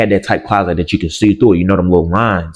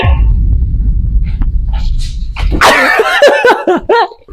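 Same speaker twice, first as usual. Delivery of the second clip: high shrieking laugh; 3 dB louder than usual. Real, not acted. Shrieking laugh at own story.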